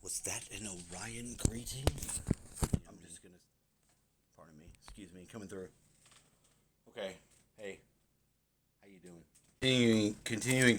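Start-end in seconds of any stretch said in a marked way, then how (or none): 0.1-1.8 hushed whispered echo. Retold or imitated. Echoes the reader under breath. 2.9-9.2 murmured under the breath; barely voiced, sneaking past; very quiet. Real, not acted. Squeezing past someone quietly.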